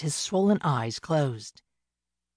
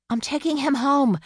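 high, raised voice at the birds; defiant yell; 9 semitones higher, 4 dB louder than usual. A narrator's reading. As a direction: call it out in defiance. Yelling at birds while dragging him.

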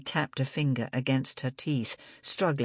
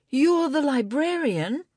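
high rising surprised question; surprised realisation; 12 semitones higher, 3 dB louder than usual. Acted. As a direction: gasp it as you realise. Libby realising.

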